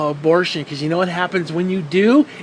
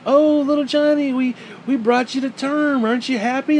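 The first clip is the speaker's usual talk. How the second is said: mock proud parent; 7 semitones higher than usual. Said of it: "parents voiced in rant".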